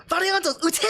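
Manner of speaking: Valiantos ute called high and punched on ute; huge emphasis. Retold or imitated. Fan imitating the Japanese captain's fire order, big emphasis on ute. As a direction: hit ute hard like a battle cry. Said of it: "she says it with this huge emphasis".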